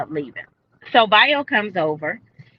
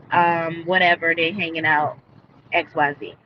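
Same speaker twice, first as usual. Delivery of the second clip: ordinary talk, nothing marked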